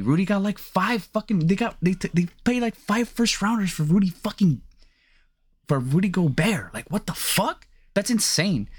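high-pitched exasperated rant about the trade; outraged disbelief. Real, not acted. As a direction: voice jumps up in incredulous outrage.

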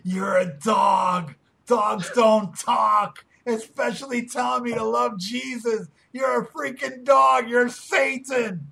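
drawn-out outraged cartoon boy yell; slow angry yelling. Acted cartoon quarrel with dog.

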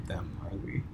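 hushed aside whisper. Real, not acted. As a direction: whisper it so others miss it. Checking a secret topic.

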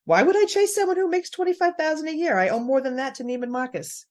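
high, haughty quoted Danielle; imitating Danielle. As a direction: sniff it like a snob. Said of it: "favorite thing that Danielle has ever said".

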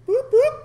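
high, loud siren noise; playful mock siren. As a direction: squeal it like a police siren. Imitating military police siren.

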